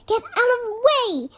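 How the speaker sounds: high, loud snapped order; irritated shout to shift someone; angry snap. A narrator's reading. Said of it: "snapped Ron".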